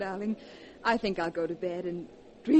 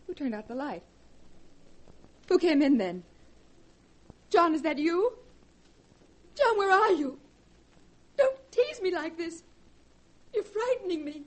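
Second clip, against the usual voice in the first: rising higher into a scream; growing terror; 8 semitones higher than usual. Acted. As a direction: fear builds to a cry. Alone in dark, frightened.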